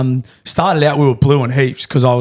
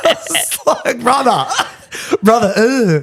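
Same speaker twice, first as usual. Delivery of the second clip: laughing; 9 semitones higher, much slower than usual. Real, not acted. Laughing at agent asking more.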